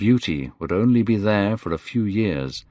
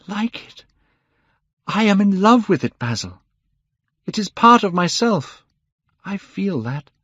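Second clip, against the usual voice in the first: impassioned declaration; 11 semitones higher than usual. A narrator's reading. Dorian confessing love for the portrait, fervent. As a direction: swell with feeling, then hush.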